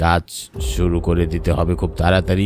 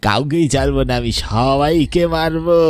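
slow, drawn-out ghost threat, higher; ghostly threat; 7 semitones higher than usual. Acted. The ghost vows to kill everyone, slow and menacing.

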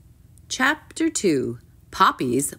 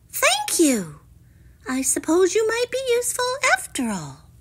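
high, quick princess voice; pitched-up girlish concession; grudging princess. A narrator's reading. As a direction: thank the frog in a lofty high voice. Princess grudgingly thanks frog.

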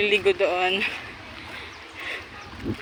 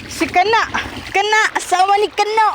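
teasing call; 11 semitones higher, 4 dB louder than usual. Real, not acted.

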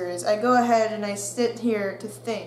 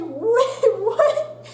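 higher, louder startled question; friends' shock; 12 semitones higher, 4 dB louder than usual. Retold or imitated. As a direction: friends hearing the tooth broke, shocked. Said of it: "you're just like".

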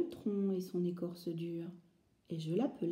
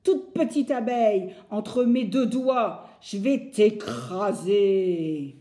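menacing woodcutter; 8 dB louder, slower than usual. Acted. Bûcheron menace l'abeille.

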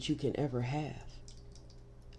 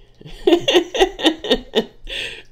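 loud, high burst of laughter; real laugh breaking out; big laugh; 14 dB louder than usual. Real, not acted. Reader cracking up over Eddie Murphy.

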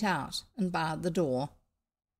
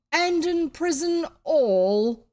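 slow, high, louder decree; royal order; 9 semitones higher, 8 dB louder, slower than usual. A narrator's reading. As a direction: pronounce the sentence grandly. Pharaoh orders imprisonment.

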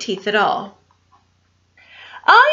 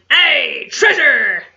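pirate shout; 2 semitones higher, slower than usual. Acted. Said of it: "the others shouted".

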